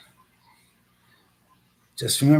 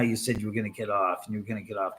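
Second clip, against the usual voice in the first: normal talking voice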